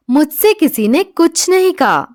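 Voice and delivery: ordinary talk, nothing marked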